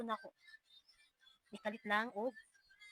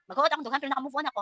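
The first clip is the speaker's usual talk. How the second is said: louder, pushing through the tightness; louder, emphatic; 6 semitones higher, 9 dB louder, much faster than usual. Real, not acted. Mom insisting to viewers her chest feels tight, loudly. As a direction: force it out with feeling.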